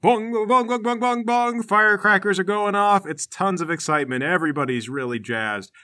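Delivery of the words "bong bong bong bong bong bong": high, ringing vocal bongs; bong rapidly like struck gongs; imitated gong strikes. Game master sounding gongs at the palace gates, imitated gongs. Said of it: "you start to hear gongs".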